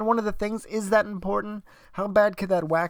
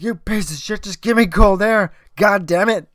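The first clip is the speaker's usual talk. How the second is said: louder, higher re-enacted outburst; pissed-off rant; 4 semitones higher, 8 dB louder than usual. Cursing the air conditioner for cold air, pissed-off rant. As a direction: imitate yelling in exasperation at a broken machine. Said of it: "I was so fucking pissed".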